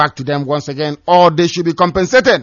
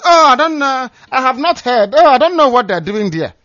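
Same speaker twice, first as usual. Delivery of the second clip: high, pretending not to know; mock innocent denial; 8 semitones higher than usual. Mocking people who pretend not to listen. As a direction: play dumb in a raised innocent voice. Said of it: "They pretend they don't".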